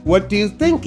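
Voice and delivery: plain reading voice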